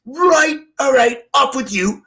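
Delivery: barking sergeant. Retold or imitated. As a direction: bark the dismissal like a parade-ground sergeant.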